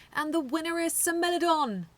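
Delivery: loud, high announcer call. A narrator's reading. Referee announcing the winner to everyone, loud. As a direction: proclaim the winner to the crowd.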